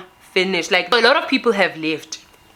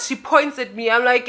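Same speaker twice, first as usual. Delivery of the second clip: usual speaking voice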